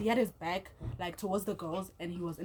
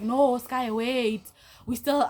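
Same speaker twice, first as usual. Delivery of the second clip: raised, louder appeal; pleading guy; 4 semitones higher, 6 dB louder, much slower than usual. Retold or imitated. The guy calling after her as she leaves, pleading in a raised voice. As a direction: imitate him urgently asking her to wait.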